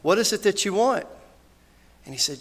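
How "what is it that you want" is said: high, loud question to the man; raised voice, retold question; acted calling out. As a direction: call the question out clearly. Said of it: "he stopped and he yelled".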